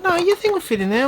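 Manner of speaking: high voice, imitating the seller; high mock voice. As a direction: squeak it out like the seller. Quoting the seller.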